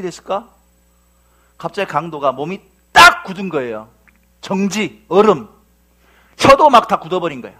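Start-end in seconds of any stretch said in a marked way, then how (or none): none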